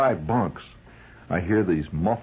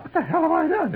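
high, panicky question; mock panic at the sergeant's scrutiny; alarmed protest. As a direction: squeak it out in alarm.

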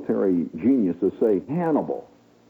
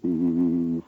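ghost voice; much slower than usual. Retold or imitated. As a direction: intone it like a spirit from beyond. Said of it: "comes a ghostly voice".

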